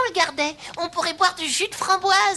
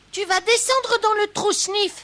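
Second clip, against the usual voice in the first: ordinary talk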